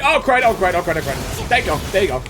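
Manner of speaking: ordinary talk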